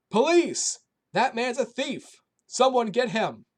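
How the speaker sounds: high, loud alarm cry; shouting for police. A narrator's reading. Robbed man calling for the police, loud high shout. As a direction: yell for help down the street. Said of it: "the man shouted".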